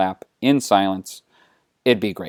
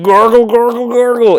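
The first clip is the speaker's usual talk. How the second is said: loud, high gargled Wookiee warble; comic creature noise made of rolled nonsense syllables; Chewbacca; 11 semitones higher, 8 dB louder than usual. Acted. Chewie answers Han, in a loud warbling gargle. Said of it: "And Chewie's all like".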